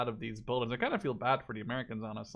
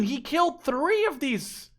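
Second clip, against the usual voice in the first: higher, louder, slow amazement; excited; 12 semitones higher, 6 dB louder, slower than usual. Real, not acted. Commentator still amazed at the lone soldier, higher and slow. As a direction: savour each word in awe.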